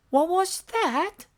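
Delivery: small eager monster voice; Elmo voice. A narrator's reading. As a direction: squeak it up like Elmo. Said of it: "asked Elmo".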